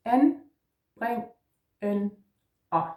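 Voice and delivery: "En, breng, en, a" said one syllable at a time with a pause between each; split into syllables. Shown as a demonstration. Danish teacher sounding out each syllable for students. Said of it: "This word has four syllables".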